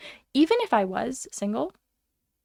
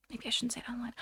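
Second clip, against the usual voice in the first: sheepish whispered aside; 13 dB quieter, much faster than usual. Real, not acted. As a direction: hush it as if someone might hear. Guilty aside after violent remark.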